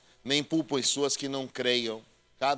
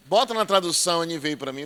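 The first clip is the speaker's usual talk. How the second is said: usual speaking voice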